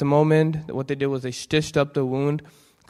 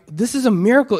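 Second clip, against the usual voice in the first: surgeon's amazed exclaim, higher and louder; 8 semitones higher, 8 dB louder, faster than usual. Retold or imitated. Surgeon tells the patient, amazed and loud. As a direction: marvel at it out loud. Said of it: "he's like".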